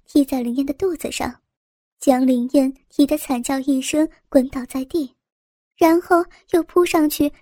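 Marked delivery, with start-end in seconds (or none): none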